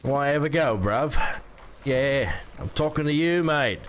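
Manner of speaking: badly faked British accent, drifting Australian; cheeky attempt at British slang accent. Streamer trying British on request, shaky grasp of the British accent. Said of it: "A British accent".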